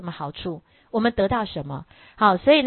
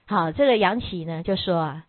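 normal talking voice

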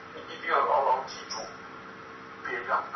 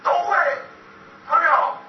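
loud, rising appeal to the crowd, higher and louder at the end; rousing preaching; 4 semitones higher, 6 dB louder than usual. Acted. Speaker rallying the audience as ordinary friends, preaching loudly. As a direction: build them up like a preacher at full force.

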